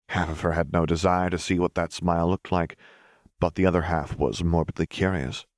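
plain reading voice

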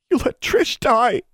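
high, drawn-out tearful cry; crying accusation. A narrator's reading. Crying boy accuses heroine over a fallen girl, tearful wail. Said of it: "He was crying".

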